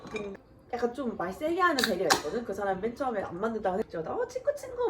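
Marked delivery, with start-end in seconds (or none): 4.1-4.9 mocking coworker. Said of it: "막 이러고".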